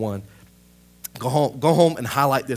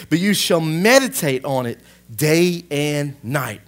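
forceful scripture reading; 4 semitones higher, 6 dB louder than usual. Real, not acted. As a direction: preach the verse out, stretching the key words. Reads scripture aloud with force.

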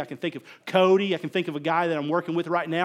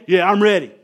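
louder, pumped-up answer; louder pumped reply; 7 dB louder than usual. Retold or imitated. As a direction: answer like you're fired up now. Leader answers coach's pep talk.

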